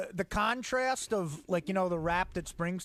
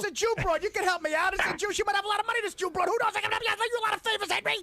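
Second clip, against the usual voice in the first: high pushy mobster; Pesci wiseguy voice; 10 semitones higher, faster than usual. Acted. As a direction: wheedle it like a wiseguy. Goodfellas scene acted out.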